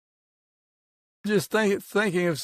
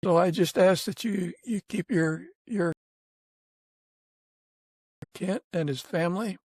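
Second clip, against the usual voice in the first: earnest, unhurried prayer voice; gentle closing prayer, reverent and steady. Real, not acted. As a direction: pray sincerely over the guest and his family.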